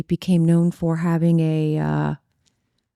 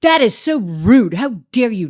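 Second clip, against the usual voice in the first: retold scolding; 3 semitones higher, 4 dB louder than usual. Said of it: "And he goes".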